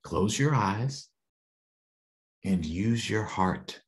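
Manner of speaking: gentle voice. Acted. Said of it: "the voice said".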